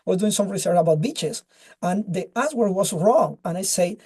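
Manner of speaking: ordinary talk, nothing marked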